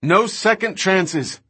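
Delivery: high, drawn-out call; shouted remark. A narrator's reading. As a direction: call it out slowly over the engine. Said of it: "she shouted".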